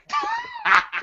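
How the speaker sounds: squealing falsetto laugh; high-pitched laugh. Retold or imitated. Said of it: "I was like".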